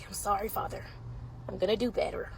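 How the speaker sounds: normal talking voice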